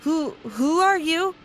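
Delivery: high, slow, halting fearful question; scared of the intruder; frightened. Acted. Narrator voices startled captive facing intruder.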